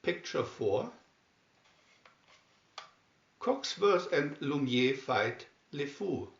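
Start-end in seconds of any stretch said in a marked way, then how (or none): none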